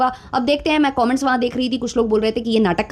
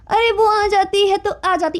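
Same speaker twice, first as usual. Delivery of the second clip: higher, whiny mock reply; mocking the wife's voice-over; 7 semitones higher than usual. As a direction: parody her lame answer. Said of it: "उस पर voice over चल रहा है". (पत्नी की आवाज़ की नकल, ऊँची ठुनकती आवाज़)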